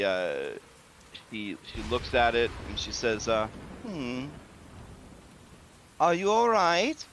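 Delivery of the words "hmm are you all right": hostess voice. Acted. Hostess asks the skeleton.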